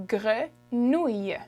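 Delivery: Grenouille, said slowly, each sound clear; slow French grenouille. Shown as a demonstration. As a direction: draw out Grenouille for learners. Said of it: "How do you say frog in French?".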